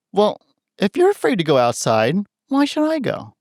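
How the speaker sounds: a lightly voiced dog, pitch jumping way up on the question; playful dog voice talking back to its owner; voicing the dog. Pet psychic voicing the dog talking back to its owner, playful and high. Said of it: "and goes".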